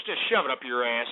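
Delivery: ordinary talk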